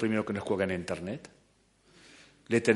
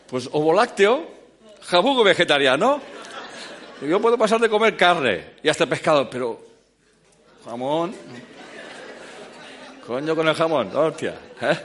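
laughing; 5 semitones higher, 3 dB louder than usual. Real, not acted. Laughing about his diet label.

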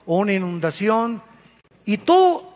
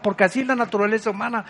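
usual speaking voice

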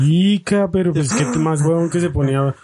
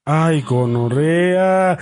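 drawn-out groan; much slower than usual. Real, not acted. Friend reacting to tense story.

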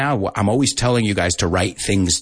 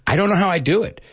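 higher, playful boast; a hammed-up vain sigh; mock vain; 10 semitones higher, much faster than usual. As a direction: brag like a shampoo ad. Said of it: "mock vanity".

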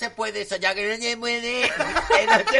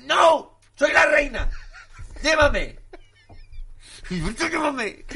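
spoiled queen yelling; 7 semitones higher, slower than usual. Pretend. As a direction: demand to be carried like a pampered queen. Reina exige al mayordomo. (reina caprichosa gritando que la lleven)

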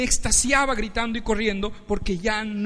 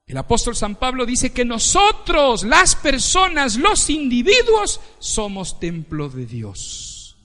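loud, high sermon build; full-force preaching; 5 semitones higher, 7 dB louder, slower than usual. Acted. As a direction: drive each phrase home from the pulpit.

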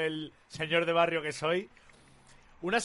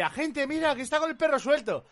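higher, lightly voiced tattling to the officer; snitching neighbour; 8 semitones higher than usual. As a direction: mimic her reporting the loose dog. Imitating the neighbour snitching to police.

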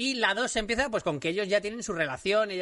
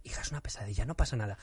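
soothing father; 9 semitones lower, 6 dB quieter than usual. Retold or imitated. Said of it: "los seis meses".

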